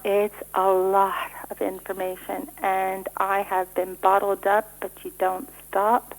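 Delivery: slow, measured delivery. Real, not acted. Guest answering an interviewer who said she talks too fast, slowing down. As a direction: take it slowly, word by word. Slows after being told to slow down.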